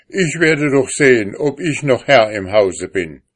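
lauter, entschlossen; trotziger Ehemann. A narrator's reading. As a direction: sich als Herr behaupten. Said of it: "antwortete der Mann". (Mann trotzt der Frau an der Tür)

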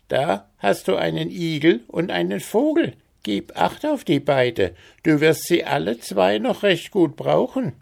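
leicht gespielte alte Frau, höher; altes Weib. Acted. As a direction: eine Spur von alter Zauberin. Alte Frau gibt Hans Geschenke. (leicht gespielte alte Frau, höher)

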